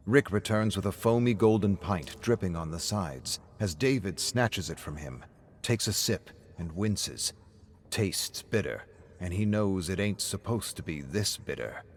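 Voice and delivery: usual speaking voice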